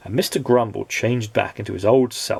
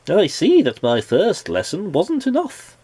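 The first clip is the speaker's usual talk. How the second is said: lightly voiced wizard; 8 semitones higher than usual. Wizard scolds again.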